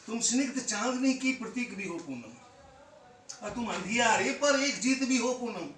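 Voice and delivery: loud, lifted declamation, softening on the last line; impassioned poetry recitation. Acted. Poet praising his beloved Poonam before an audience, impassioned recitation. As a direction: declaim each line to the room, landing on the name.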